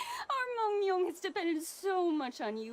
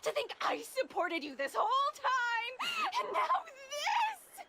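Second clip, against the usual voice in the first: cry-laughing shout, rising much higher; tearful outburst; 8 semitones higher than usual. Acted. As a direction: wail it, half laugh half sob. Wife's hurt outburst at husband.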